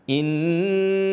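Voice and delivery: "Inna" with the nn held long; long doubled n. Shown as a demonstration. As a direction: hum on the n of "Inna". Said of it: "iya benar dibaca panjang". ("Inna" dengan nun didengungkan panjang)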